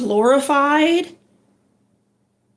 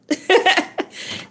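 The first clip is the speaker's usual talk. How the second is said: laugh; 6 semitones higher than usual. Real, not acted. Laughing at own remark.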